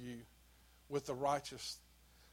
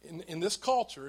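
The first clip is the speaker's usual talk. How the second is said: usual speaking voice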